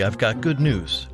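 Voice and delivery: ordinary delivery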